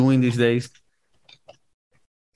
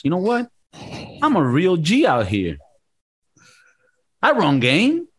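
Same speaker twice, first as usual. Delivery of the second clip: self-hype; 4 semitones higher than usual. Pretend. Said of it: "actually saying to yourself sometimes".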